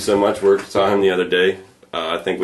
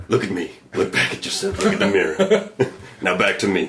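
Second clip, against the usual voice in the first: put-on Old Spice guy; 3 semitones higher than usual. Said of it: "They get the Old Spice guy".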